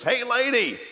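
high-pitched parrot calling out; squawking parrot. Acted. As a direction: call it out like a pet-shop parrot. Parrot heckles her once more.

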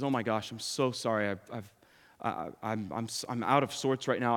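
lightly voiced father, overwhelmed and lost; shaken grieving father. Grieving father apologizes on subway.

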